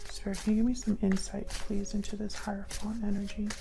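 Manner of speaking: quiet, reverent ask; hushed request to spirit. Real, not acted. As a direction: ask the spirit softly, almost under the breath. Asking spirit for insight.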